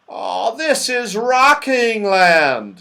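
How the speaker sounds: high, loud, drawn-out wail; dismayed. A narrator's reading. Moonface recognises the dangerous land, dismayed and loud. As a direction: groan it out loudly, very stretched. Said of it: "said Moonface in dismay".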